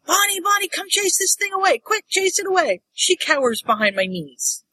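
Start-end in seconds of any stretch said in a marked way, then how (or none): 0.1-2.8 high, urgent call re-enacted; calling the dog. Owner calls her dog to chase a possum away, high urgent call. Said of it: "So I call Bonnie".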